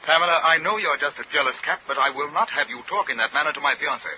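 indignant. Acted. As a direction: put her firmly in her place.

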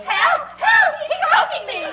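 high cries for help; terrified scream. Acted. Actresses try out the choking line, terrified screaming. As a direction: shriek it in hammed-up terror. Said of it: "give a terrifying scream and then say".

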